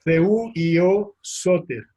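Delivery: very slow, careful Greek words; Greek anagram spelled out. Shown as a demonstration. Lecturer spelling out the anagram for students, very slow. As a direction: lay out "Theou Io Soter" word by word. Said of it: "un anagrama para decir Jesús".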